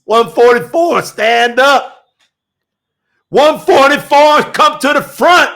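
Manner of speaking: loud, high, fiery sermon climax; full-force preaching. Acted. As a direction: proclaim it like a revival preacher.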